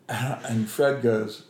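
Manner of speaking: usual speaking voice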